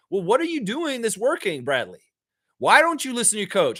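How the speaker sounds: ordinary talk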